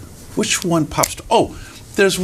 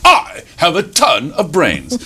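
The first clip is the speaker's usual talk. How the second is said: slow, drawn-out brag; boastful fox; 4 semitones higher, slower than usual. Acted. Fox brags to the rabbit, pompous and slow. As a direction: stretch I and TON grandly.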